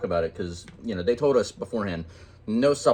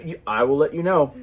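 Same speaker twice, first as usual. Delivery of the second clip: ordinary delivery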